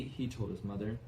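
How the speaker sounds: ordinary delivery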